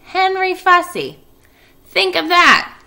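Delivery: high, loud, slow mother's wonder; Mrs Arable marvelling. Acted. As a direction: marvel at the boy's name.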